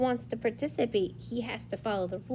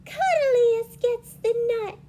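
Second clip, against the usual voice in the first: high, loud, drawn-out little rabbit voice; littlest rabbit; 12 semitones higher, 7 dB louder, slower than usual. Acted. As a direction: plead slowly as a tiny rabbit. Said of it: "said Littlest Rabbit".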